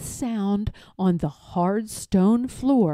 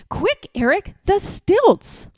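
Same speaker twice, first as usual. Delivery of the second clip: higher, louder eager call; excited shout; 9 semitones higher, 4 dB louder than usual. Acted. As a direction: yell it like a boy at play. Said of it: "he'd shout".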